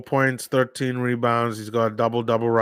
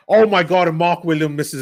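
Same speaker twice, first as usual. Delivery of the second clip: excited outburst; 6 semitones higher, 5 dB louder than usual. Real, not acted. Opponent misses free throw.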